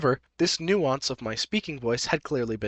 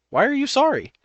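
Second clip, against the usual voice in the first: high, louder acted indignation; indignant retort; 13 semitones higher, 6 dB louder than usual. Narrator voices Happy answering OP's sorry, indignant. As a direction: throw it back, pitched way up.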